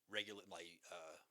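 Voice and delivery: usual speaking voice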